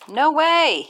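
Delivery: put-on a boy's shocked loud refusal; blurted. A narrator's reading. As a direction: blurt it out before thinking. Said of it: "he blurts".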